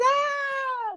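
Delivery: high, loud shriek of shock. Real, not acted. Hearing she's having twins, a high shocked shriek. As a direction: squeal out in disbelief.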